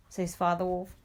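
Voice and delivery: ordinary delivery, nothing marked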